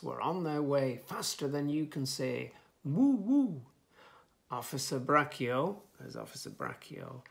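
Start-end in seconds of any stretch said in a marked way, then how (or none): 2.8-3.6 high, loud siren woo-woo; swooping mock siren; siren wail. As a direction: wail like a police siren, rising and falling. Police siren noise in story.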